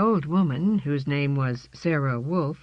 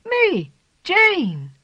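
excited; 8 dB louder than usual. A narrator's reading. Kitten answering mother.

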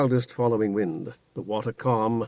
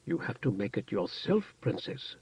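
much softer, firm but kind; quiet firmness; 10 dB quieter than usual. A narrator's reading. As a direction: say it softly but firmly. Gentle firm reply, quiet.

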